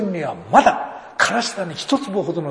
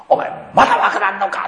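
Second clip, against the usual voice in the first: angry officer's look; 6 semitones higher, 7 dB louder than usual. Retold or imitated.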